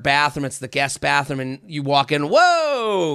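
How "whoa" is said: surprised whoa. Retold or imitated. Reacting to smelly guest bathroom.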